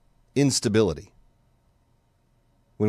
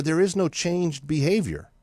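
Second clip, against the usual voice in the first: usual speaking voice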